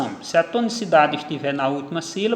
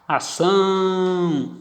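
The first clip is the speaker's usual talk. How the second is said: Ação with ÇÃO stretched very long; stress on last syllable; 3 semitones higher, much slower than usual. Shown as a demonstration. Teacher demonstrating final stress, Ação very slow on ÇÃO. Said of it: "Tonicidade na última sílaba".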